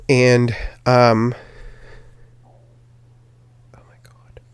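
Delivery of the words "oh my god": whispered exasperated aside. Real, not acted. Whispering to herself before opening her notes. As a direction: barely breathe it out, voiceless. Aside while losing track of the story.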